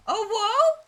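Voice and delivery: gasp, then a high rising squeak; sudden high-pitched wonder; breathy-in gasp and a soaring "whoa"; amazed whoa. Acted. Amazed reaction while playing.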